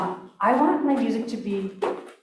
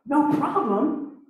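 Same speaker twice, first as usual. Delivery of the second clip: mock freak-out; 7 semitones higher, much slower than usual. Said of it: "his wife freaks out".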